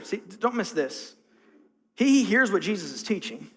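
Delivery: ordinary talk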